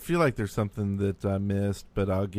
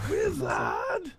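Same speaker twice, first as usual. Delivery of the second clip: high squeaky shout; 3 dB quieter than usual. Acted. Joking correction of lizard.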